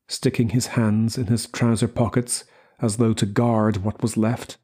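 ordinary delivery

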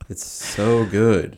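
slow, drawn-out relish; savoring delicious food. Real, not acted. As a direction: stretch out "so good" with pleasure. Savoring dessert, slow and loud.

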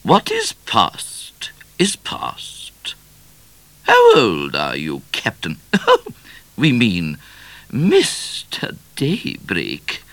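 high, very slow, stately queen voice; regal Queen. A narrator's reading. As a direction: hold court as a grand queen, drawing out the words.

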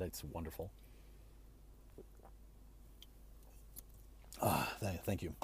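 just swallowed a sip; words right after a drink; while sipping a drink. Real, not acted. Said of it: "let me take a sip of this LaCroix".

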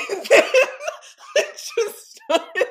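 loud high laughter; cracking up while telling the dream; laughing. Real, not acted. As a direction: laugh helplessly through it. Laughing hard.

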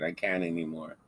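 usual speaking voice

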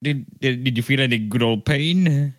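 ordinary delivery

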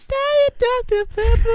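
very high, slow little mascot voice; tiny commercial guy. Retold or imitated. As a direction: squeak it out like the tiny soda mascot. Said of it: "is he that tiny guy in those commercials?".

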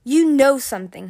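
mildly menacing, pressed firmly; low, dangerous. A narrator's reading. Winter threatens his captive, dangerous. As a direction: accuse with a touch of danger. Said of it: "he said in a low, dangerous voice".

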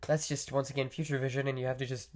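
usual speaking voice

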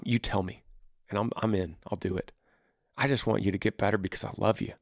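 low, soft, unhurried loving promise; hushed, sincere devotion with long pauses; tender reassurance. Acted. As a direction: gently voice a devoted wife soothing her husband. Models wife's loving, selfless reply.

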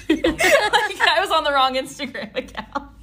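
high laughing burst. Real, not acted. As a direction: laugh through the words. Laughing at own blunder.

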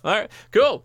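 high, loud sarcastic all right; mock acceptance. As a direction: toss it off with mock cheer. Mocking hero's offer.

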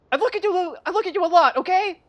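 high, loud mock-earnest blurt; awkward character quote. Retelling the movie's awkward line, high and loud. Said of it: "he was just like".

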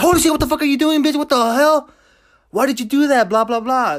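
high, loud, fast yell of disbelief; hammed-up horrified shouting at a friend; shocked outburst. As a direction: blurt it out like you just saw something horrifying. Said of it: "if it was me, I'd be like".

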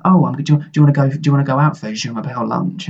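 tossing in je m'appelle with a mock French lilt; mock French twang. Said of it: "French twang to whatever I'm saying".

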